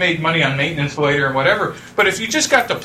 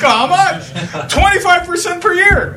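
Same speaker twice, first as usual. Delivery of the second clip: laughing; 12 semitones higher, 4 dB louder than usual. Retold or imitated. Retelling Sandy, laughing.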